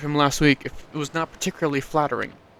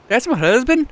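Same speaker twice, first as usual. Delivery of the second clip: put-on Swedish accent; 10 semitones higher, 5 dB louder than usual. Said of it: "Why am I Swedish?".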